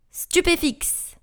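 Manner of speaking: high, drawn-out incantation; cried-out spell. A narrator's reading. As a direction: call out the spell, stretching it. Harry casts a spell in battle.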